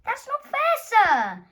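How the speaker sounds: high, loud indignant protest; protesting girl. Acted. Amelia protesting to the teacher, high and indignant. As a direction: stand up for your friend, outraged.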